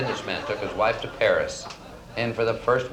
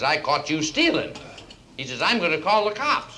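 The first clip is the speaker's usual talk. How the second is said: indignant accusation; 8 semitones higher, 3 dB louder than usual. Acted. Old boss accuses secretary.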